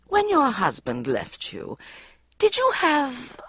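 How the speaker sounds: slow gentle interviewer tone; caring, understanding. A narrator's reading. Mother interviewing Bridget on TV, slow and caring. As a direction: soothe her, very slowly. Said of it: "in a caring, understanding voice".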